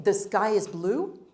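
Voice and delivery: "the sky is blue" rising at the end like a question; uptalk. Shown as a demonstration. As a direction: let "blue" go up, as if asking. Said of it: "the difference between".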